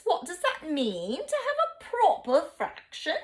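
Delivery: high, prim British voice; mock upper-class British accent; posh British. Shown as a demonstration. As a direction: ask it like a prim British lady. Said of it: "your British accent and your pinkies are out".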